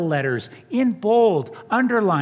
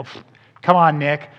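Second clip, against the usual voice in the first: louder mock scoff at Nick; dismissive scoff; 3 semitones lower, 6 dB louder than usual. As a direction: brush off his question with a pfft. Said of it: "we kinda go".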